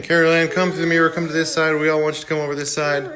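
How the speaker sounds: high, hurried mock summons; calling out. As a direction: imitate coaxing someone over from afar. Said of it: "Tangina's calling to Carol Ann".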